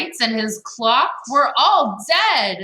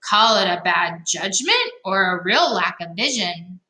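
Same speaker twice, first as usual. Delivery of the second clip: ordinary delivery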